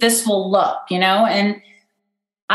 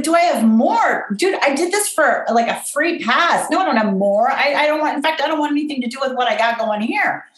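higher-pitched, flustered retold protest; incredulous protest; 6 semitones higher than usual. Overwhelmed teacher to class wanting more, incredulous. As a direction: protest as if the request is absurd.

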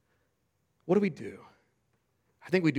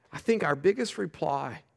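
usual speaking voice

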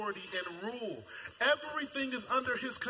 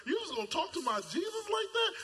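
mock indignant; 9 semitones higher than usual. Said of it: "I'm just so offended".

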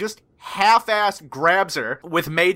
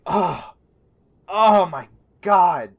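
slow, drawn-out dismay; exasperated; much slower than usual. Acted. Player's character looking at the fallen rocks, weary exasperation. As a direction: groan it in weary disbelief.

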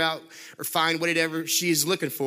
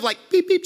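mock little honk; 13 semitones higher, 6 dB louder than usual. Imitating a friendly little honk.

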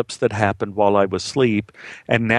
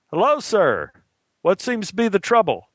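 higher-pitched call, no real shout; 8 semitones higher than usual. Acted. Cops call to him across the chasm, calling out. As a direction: cops hailing a stranger from afar. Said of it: "They called out".

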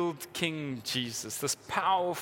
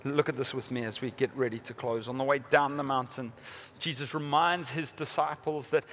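usual speaking voice

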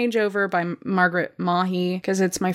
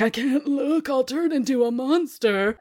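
higher, mock-tortured detective voice; brooding TV detective; 5 semitones higher than usual. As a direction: ham up an angsty cop show hero. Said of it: "on fucking C S I just being like".

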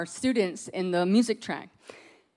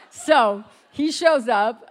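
ordinary talk, nothing marked

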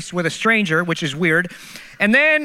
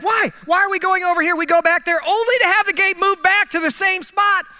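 exasperated outburst; 9 semitones higher than usual. Retold or imitated. Exasperated retelling of gate changes.

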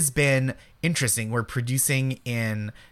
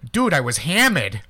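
Rhode Island accent, Peter Griffin-style, a bit louder; 3 semitones higher, 5 dB louder than usual. Shown as a demonstration. Asked by the host for a sample, a short Rhode Island accent. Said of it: "give us a little of your Rhode Island accent".

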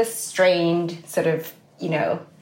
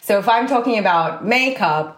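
normal talking voice

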